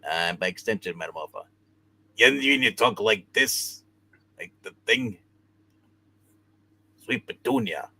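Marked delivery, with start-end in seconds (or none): 2.2-7.9 lightly voiced Ben Grimm, the Thing; a hint of the rocky Thing voice; extremely deep bass voice. Shown as a demonstration. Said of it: "lowest voice I can do is Ben Grimm".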